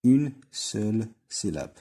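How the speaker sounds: une seule syllabe drawn out very slowly, une stressed; slow syllable count. Shown as a demonstration. Teacher counting syllables for pupils, very slow. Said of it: "إذا هنا".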